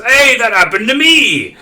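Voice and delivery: loud, high holler of recognition; big delighted outburst, as a character; excited shout. Acted. Chop exclaims in shared recognition.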